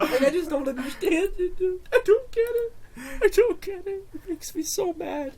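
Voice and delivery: fake-sob it like a fan who's lost it; half-joking meltdown voice, high and teary; mock breakdown. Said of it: "this is my mental breakdown".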